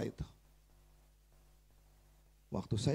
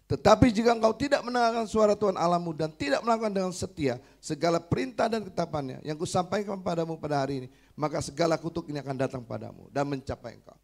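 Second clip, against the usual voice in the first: loud, higher sermon reading voice; scripture reading; 4 semitones higher, 6 dB louder than usual. Acted. Preacher reading the wife's Bible verses aloud, proclaiming loudly.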